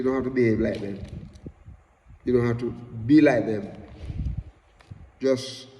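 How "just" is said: ordinary delivery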